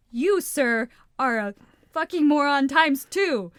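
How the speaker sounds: loud, emphatic pronouncement of an insult; mock insult. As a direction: punch it out loudly as a pretend put-down. Acting out the insult she withheld.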